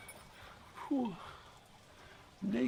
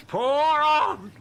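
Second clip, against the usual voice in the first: bellowed high and loud into the storm; raging shout; 10 semitones higher, 9 dB louder than usual. Acted. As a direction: hurl it at the heavens.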